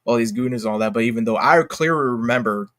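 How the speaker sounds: ordinary talk, nothing marked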